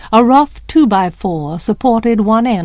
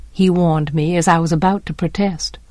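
plain reading voice

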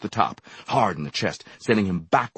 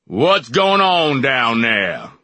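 slow, loud call from a balcony; loud slow shout; shouted from above; 6 semitones higher, 9 dB louder, much slower than usual. A narrator's reading. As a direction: boom it down the stairs. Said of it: "a voice shouted from the balcony".

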